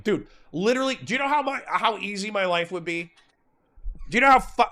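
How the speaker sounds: venting real frustration, voice climbing high; frustrated outburst. Real, not acted. Streamer venting to Connor about politics, pitch jumping up in real frustration. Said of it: "I'm, like, so pissed right now".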